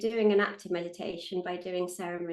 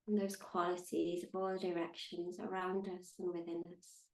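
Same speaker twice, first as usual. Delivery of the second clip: calm, unhurried meditation guide with long pauses; measured, soothing meditation voice; guided meditation; 3 dB quieter than usual. Acted. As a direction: guide them gently into stillness, leaving space between phrases.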